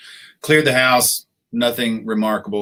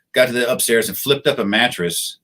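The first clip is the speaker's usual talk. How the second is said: ordinary delivery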